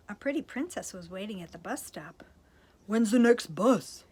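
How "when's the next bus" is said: loud, slow monster grunt. Acted. Hairy prince asks the princess about the bus, grunting loudly. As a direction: growl it like a big hairy monkey. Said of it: "he grunted".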